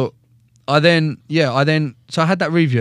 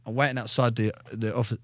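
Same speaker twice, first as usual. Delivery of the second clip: usual speaking voice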